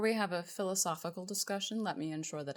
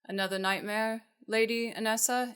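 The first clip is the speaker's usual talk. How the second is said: mimicking the guard, higher and louder; guard's voice; 6 semitones higher, 6 dB louder than usual. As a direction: call it out in the guard's voice. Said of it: "using the guy's voice".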